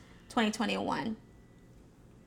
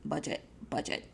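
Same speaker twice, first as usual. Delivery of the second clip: whispered repeat; 6 semitones lower, 10 dB quieter, faster than usual. Shown as a demonstration. Speaker repeating the lesson to the audience, whispering "budget, budget". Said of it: "repeated chant, whispered".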